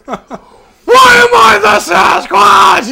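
laughing high shriek of outrage; mock shriek. Ex screams over phone, laughing.

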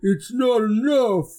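loud, long-drawn ogre wail; moaning ogre. Acted. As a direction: moan it out slowly and loudly, pitch pushed up. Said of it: "the deep, like, kind of moaning voice of the ogre".

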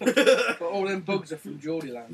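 put-on Geordie accent. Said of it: "a funny accent to say things in".

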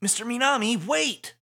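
high cry after someone; desperate call. Acted. Player calling after Minami as he leaves, desperate. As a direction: call out pleadingly.

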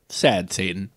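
sad Satan dropped low and soft; low ominous ending. Pretend. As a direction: let it sink low and soft. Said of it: "it's supposed to say".

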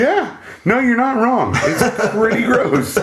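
high voice breaking into laughs; laughing. Real, not acted. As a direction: laugh through the words. Laughing at gross monster.

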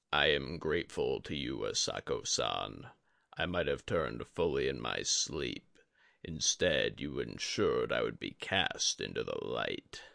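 a dying sensei, calm and heavy; Yori the old master. A narrator's reading. Yori thanks Asako woman.

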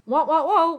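high, loud "wah wah wah"; mock dramatic sting. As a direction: sound out a comic plot-twist sting. Mimics dramatic sting before twist.

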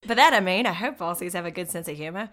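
put-on Aussie accent, playfully; mock Australian accent. Host echoing co-host's hope about Aussie humor, mock Australian accent.

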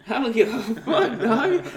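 loud, high, laughing. Real, not acted. Laughing repeat, louder.

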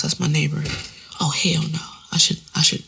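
ordinary talk, nothing marked

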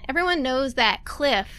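ordinary delivery

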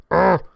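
loud frustrated groan; angry noise. Acted. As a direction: let out a big exasperated ugh. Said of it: "This is my angry noise".

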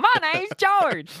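high, slow, hammed-up Kentucky twang; loud cartoonish Kentucky drawl; bad Kentucky accent. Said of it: "It's always Kentucky".

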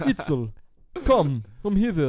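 high, loud theatrical come hither; hammed-up stage romance. Playing the lover calling Itzel, hammed-up. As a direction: ham up the romantic lead.

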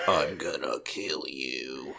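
very low, slow, quiet threat; menacing gruff voice. Retold or imitated. Co-host spoofing the scary answering-machine man, low slow menace. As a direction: growl it deep and slow like a villain.

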